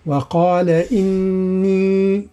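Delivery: "وقال إني" with the ي of إني drawn out very long; madd on إني. Shown as a demonstration. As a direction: stretch the ي of إني in "وقال إني" slowly. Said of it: "الإتيان بالمدود". ("وقال إني" بمد الياء)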